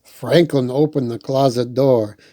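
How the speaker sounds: ordinary delivery, nothing marked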